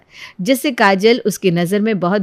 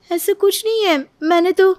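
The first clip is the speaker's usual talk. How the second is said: high, near-crying denial; tearful protest; 11 semitones higher than usual. Acted. The bride denies the accusation near tears. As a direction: plead through tears. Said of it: "काजल को कुछ बोलने का मौका ही नहीं".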